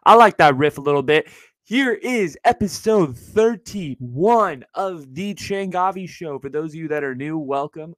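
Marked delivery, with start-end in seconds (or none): 1.6-6.4 slow newscaster announcement. Acted. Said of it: "that's my little newscaster voice".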